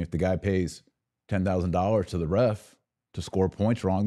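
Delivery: ordinary talk, nothing marked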